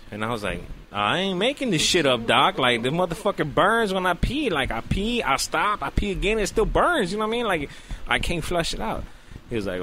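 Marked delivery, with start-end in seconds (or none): 0.9-9.0 high, insistent acted complaint; exasperated protest. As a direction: plead your case to a doubting doctor, pitched up. Retold protest to sceptical doctor.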